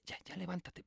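very soft, drowsy mumbled words; sleepy mumble. Retold or imitated. Just woken at dawn, groggy and quiet. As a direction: mumble it half asleep. Half-asleep exchange at dawn.

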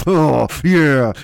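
loud, snorting mock climax; angry mock ecstasy. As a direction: snort it out with hammed-up aggression. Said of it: "just angrily seized yourself".

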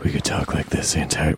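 low, hushed public-radio delivery; NPR host voice. Shown as a demonstration. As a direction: talk as if it's NPR. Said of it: "we could do like N P R".

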